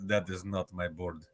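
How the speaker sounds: hushed movie-character impression; Mickey Rourke. Host recalls a film line about a bird, hushed impression. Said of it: "Mickey Rourke".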